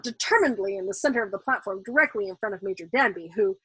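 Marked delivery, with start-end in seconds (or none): none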